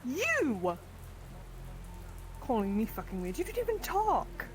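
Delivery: high-pitched, affronted squawk; indignant outburst. Acted. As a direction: swoop up high on the key words, offended. Indignant at being called weird.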